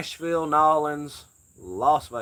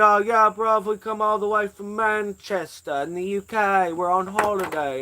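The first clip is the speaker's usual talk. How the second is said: higher, louder mock Manchester accent; imitating a British accent; 7 semitones higher, 4 dB louder than usual. Streamer imitating drunk British tourists he met. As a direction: quote the drunk Brit in his accent. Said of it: "He said".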